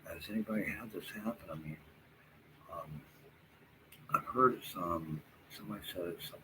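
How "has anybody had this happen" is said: normal talking voice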